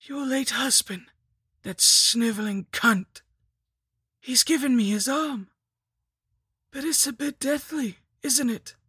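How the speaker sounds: high undead villainess, spitting the insult; scheming lich, contemptuous. A narrator's reading. Lich mocking the captive's late husband, in a high villainess voice. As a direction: sneer theatrically in a high voice.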